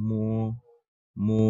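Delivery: slow classroom demo of মো; Chinese mo demo. Shown as a demonstration. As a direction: hum into মো and hold the o. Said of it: "এম এর উচ্চারণ হচ্ছে ম এর মতো".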